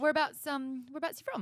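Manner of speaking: put-on English accent, voice lifted; imitated English questioner. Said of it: "he's like".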